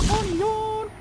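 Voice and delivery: very high squeaky yelp; squeaky onion. Pretend. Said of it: "You have to scream it".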